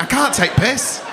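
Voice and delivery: slow, high comic wail; high, stretched-out mock complaint; high-pitched mock protest. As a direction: draw it out high, as if helplessly protesting. Acting out own reaction to crowd member.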